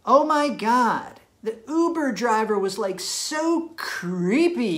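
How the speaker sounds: a hint of a chatty girlfriend dishing; girly storyteller voice. Girlfriend telling about the Uber driver, lightly girly. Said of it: "So if she says".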